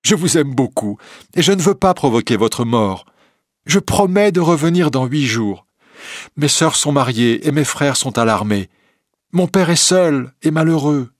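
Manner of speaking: tearful urgent plea; in tears. Acted. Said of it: "en larmes".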